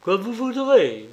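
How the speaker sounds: plain reading voice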